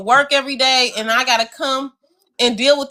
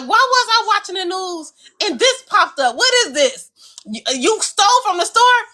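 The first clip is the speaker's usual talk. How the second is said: higher, accusing mom voice; mock confrontation; 7 semitones higher than usual. Mother grilling her son about the news clip, mock stern.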